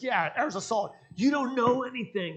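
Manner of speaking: loud, high angry retort; exasperated retelling of an angry outburst; mad. Retold or imitated. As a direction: imitate an irritated man, raising your voice sharply. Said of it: "He was mad".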